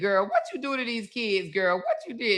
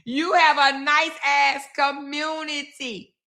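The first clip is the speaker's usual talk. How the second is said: slow, higher sarcastic echo; sarcastic repeat, drawn out and higher; mocking echo; 6 semitones higher, slower than usual. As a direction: parrot her word back with scorn. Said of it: "here we go again".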